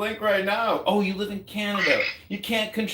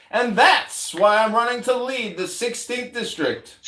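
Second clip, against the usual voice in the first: usual speaking voice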